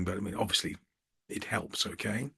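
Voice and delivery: conspiratorial murmured aside; hushed aside. Real, not acted. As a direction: slip it in under your breath as a joke. Joking aside about looks helping.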